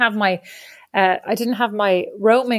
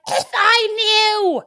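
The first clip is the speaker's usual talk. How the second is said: high, slow, exasperated; exasperated cry; 11 semitones higher, much slower than usual. Retold or imitated. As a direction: If I knew, hoog uitgerekt. Exasperated at parents.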